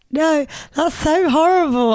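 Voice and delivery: high, drawn-out mock wail; exaggerated upset protest, slow and high; mock crying. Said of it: "she was still crying".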